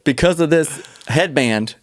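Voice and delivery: slow, laughing mock testimonial; ad read. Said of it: "The commercial's not over yet".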